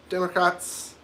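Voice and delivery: usual speaking voice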